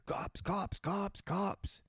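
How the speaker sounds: mock panicked whisper of cops; urgent whispered warning. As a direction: warn the crowd under your breath. Kids fleeing the party.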